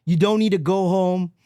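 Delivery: normal talking voice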